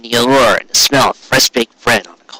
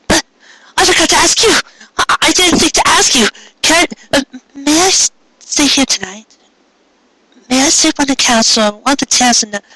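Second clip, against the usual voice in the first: much higher, stammering shy mare's voice; flustered Cupcake. Acted. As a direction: ask shyly, tripping over the words. Cupcake asks to stay, flustered.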